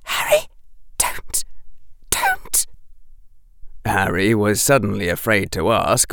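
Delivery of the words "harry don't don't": high, half-whispered frightened pleading; gasping plea. A narrator's reading. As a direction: beg in short, voiceless gasps. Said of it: "she gasped".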